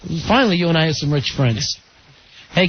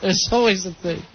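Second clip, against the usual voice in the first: usual speaking voice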